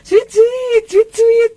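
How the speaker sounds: high, piping wolf-whistle imitation; mock catcall. Imitates teasing catcalls of ojek drivers. (menirukan siulan godaan, nada tinggi)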